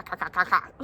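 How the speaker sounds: ca, ca, ca, ca as short crow caws; crow caw imitation. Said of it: "they do this little ca".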